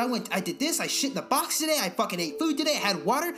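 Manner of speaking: high, fast, overexcited buddy voice; hyper happy dog. Retold or imitated. Voicing the dog greeting its gloomy owner, high and overexcited. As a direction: bounce in like a dog thrilled with everything. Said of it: "someone's just like".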